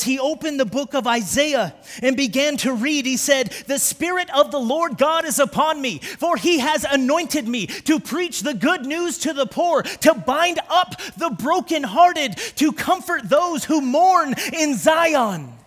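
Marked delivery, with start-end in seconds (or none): 3.7-15.5 higher and louder, slowing at the end; preaching at full force. Acted. Fervent reading that slows to a solemn close.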